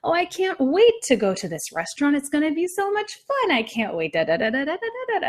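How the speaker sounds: high sing-song, swooping up and down; playful lilting voice, then hummed da da da; sing-songy voice. Shown as a demonstration. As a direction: bounce the tune like humming to yourself. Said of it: "a sing-songy voice".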